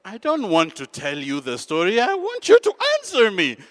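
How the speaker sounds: rising to a high, loud yell; acted frustration boiling over; exasperated outburst. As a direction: build from insistence into a shrieked demand.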